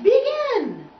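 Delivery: high, loud drawn-up cue; conductor's command. A narrator's reading. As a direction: order the orchestra to start. Conductor commands orchestra to start.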